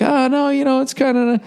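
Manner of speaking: very high mock soothing girlfriend; backpedaling girlfriend. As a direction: pitch way up and backpedal sweetly. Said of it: "pull back on the stick and be like".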